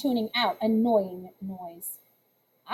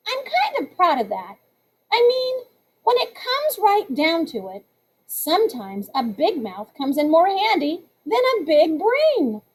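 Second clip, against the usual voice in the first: ordinary delivery, nothing marked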